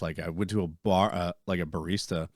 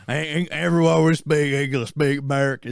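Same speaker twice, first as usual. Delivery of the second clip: loud, high, blustering American caricature; mock ignorant American; 6 semitones higher, 6 dB louder than usual. Host mocking Americans who demand English, loud blustering caricature. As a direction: bluster like a know-nothing patriot. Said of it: "here's us in the United States, and we just like".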